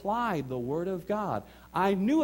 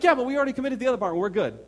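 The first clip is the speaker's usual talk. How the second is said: rushed brushoff; 8 semitones higher, 7 dB louder, much faster than usual. Retold or imitated. Brushing wife off.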